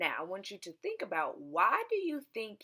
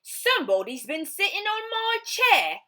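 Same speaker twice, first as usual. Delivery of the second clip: high, loud indignant dad; Mister Blair; 11 dB louder than usual. Acted. Mister Blair finding his chair sat in, high and loud. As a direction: boom out the complaint. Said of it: "said Mister Blair".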